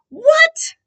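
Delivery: very high, loud disbelieving cry; can't believe what you hear; shocked What. Real, not acted. Shocked at daughter's arrest.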